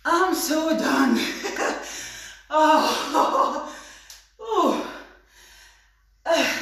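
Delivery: gasping after the workout; panting, completely spent; out of breath. Real, not acted. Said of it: "I'm really out of breath".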